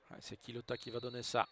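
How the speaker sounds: ordinary talk, nothing marked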